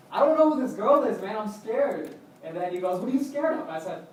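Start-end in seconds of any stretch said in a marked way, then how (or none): 0.1-2.1 high, loud protest to a friend; retold anxious protest. Telling his friend he is scared of this girl, retold anxious protest. 3.0-3.6 high, loud imitated question; mock incredulous challenge. Friend questioning his fear of the girl, mock incredulous challenge.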